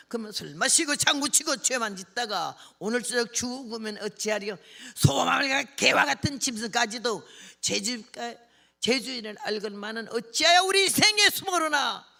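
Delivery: loud, declaimed warning, building to a climax; fiery preaching. Retold or imitated. Preacher warning old drinkers about death tonight, building fiery declamation.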